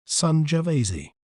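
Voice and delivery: Sangiovese as sun-juh-VAY-zee, British; British "Sangiovese" ending in -zee; British pronunciation. Shown as a demonstration. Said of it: "In British accent".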